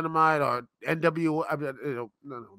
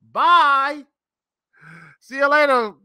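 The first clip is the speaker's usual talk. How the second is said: mock farewell; 8 semitones higher, 7 dB louder than usual.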